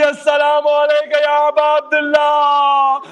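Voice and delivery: slow, drawn-out cry of grief; tearful salutation. Acted. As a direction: call to the grave through tears. Jabir weeping calls at the grave. (گریہ کے ساتھ دھیمی پکار)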